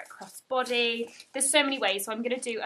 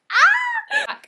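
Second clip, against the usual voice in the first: sudden high, loud squeal of joy; excited squeal; 12 dB louder than usual. Real, not acted. Unboxing her prize bag, lets out a high loud squeal. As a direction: shriek with delight at the bag.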